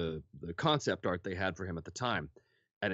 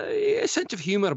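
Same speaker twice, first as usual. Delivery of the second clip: much higher, louder, impish; high playful squeak; 8 dB louder than usual. Retold or imitated. Actor voicing the character's impish humour, pitched up high. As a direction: pitch it up like a trickster.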